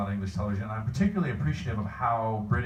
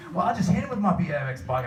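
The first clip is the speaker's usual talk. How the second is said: higher-pitched mock British accent; bad British accent; 7 semitones higher than usual. Fan quoting a British comedy sketch, bad British accent. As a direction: play a sketch character in badly faked British. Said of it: "bad British accent".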